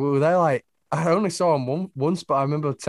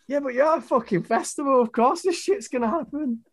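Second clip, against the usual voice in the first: indignant retort; 11 semitones higher than usual. Retold or imitated. Retorting to a complaining neighbour.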